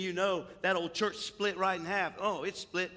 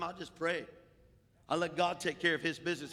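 ordinary delivery

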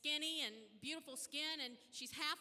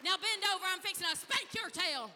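higher, louder playful scold; mock scolding; 5 semitones higher, 8 dB louder than usual. Mother scolding her rascal son, loud mock threat. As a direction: tease him, then threaten the spanking. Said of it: "I said".

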